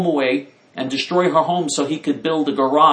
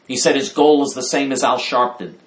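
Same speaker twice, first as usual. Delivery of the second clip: ordinary talk, nothing marked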